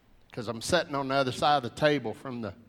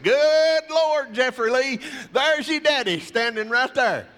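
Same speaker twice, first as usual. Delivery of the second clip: much higher, louder, with a laugh; excited exclamation; 11 semitones higher, 7 dB louder than usual. Retold or imitated. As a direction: burst out laughing as you spot the dad, higher and louder. Spotting the father in the lot.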